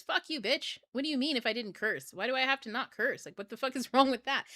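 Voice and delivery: high-pitched, indignant comeback; mock indignant retort. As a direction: fire back at your critics, half laughing. Acted retort to critics of cursing.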